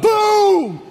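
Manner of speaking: high, loud shouted boom; crash boom. Retold or imitated. Pastor recreating the crash for the congregation, shouted boom. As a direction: slam the word out like the impact. Retelling the collision again.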